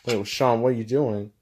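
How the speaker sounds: ordinary talk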